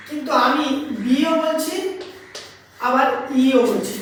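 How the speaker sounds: বিও and ইও said slowly, each sound drawn apart; teacher slowing to show the hidden ই in বি; slow clear teaching. Shown as a demonstration. শিক্ষক বিও আর ইও ধীরে ধীরে আলাদা করে বলছেন. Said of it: "ভালো করে লক্ষ্য করে দেখো".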